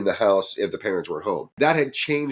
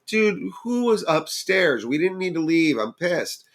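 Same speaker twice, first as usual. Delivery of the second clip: annoyed demand; 9 semitones higher than usual. Retold or imitated. Narrator confronts Gabe in the basement. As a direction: demand the truth, irritated.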